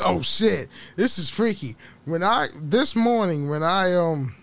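ordinary talk

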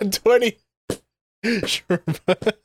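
high sarcastic laughing reply. Real, not acted. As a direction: scoff it through a laugh. Sarcastic remark while laughing.

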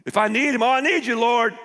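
high, loud pleading cry; desperate plea. Acted. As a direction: cry out to God in need. Acting desperate prayer to God.

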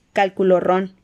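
ordinary delivery

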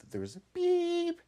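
loud high electronic beep; high beep sound. Acted. Ski jump start signal, loud high beep.

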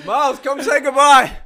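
laughing through the call. Real, not acted. Repeat dissolving into laughter.

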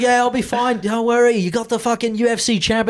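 high, loud mock Australian accent; a cocky Australian dad boasting to his daughter. Fighter dad reassuring daughter, hammed-up Australian accent. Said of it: "you were gonna do the accent".

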